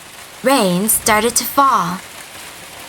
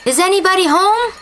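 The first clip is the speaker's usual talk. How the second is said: calling out; 11 semitones higher, faster than usual. Acted. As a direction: call toward the house. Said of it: "called out".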